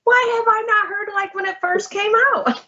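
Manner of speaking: high, exasperated question; mock outrage at missing out; high, disbelieving why-not-me; indignant disbelief. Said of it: "I was like".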